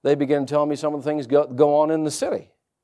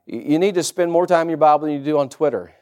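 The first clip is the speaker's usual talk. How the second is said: ordinary talk, nothing marked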